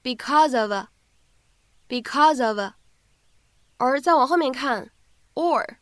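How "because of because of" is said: because of linked as becau-zof, slowly; slow linked demo. Shown as a demonstration. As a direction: join because and of in one slow flow. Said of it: "可以读成because of". (because of 慢速连读)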